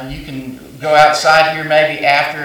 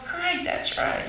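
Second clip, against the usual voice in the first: praising parent; 10 dB quieter, slower than usual. Retold or imitated.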